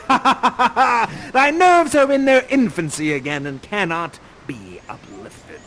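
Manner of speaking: slow, laughing through the curse; laughing sorcerer. Acted. Prospero gleefully freezing Ferdinand with magic. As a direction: cackle out the spell.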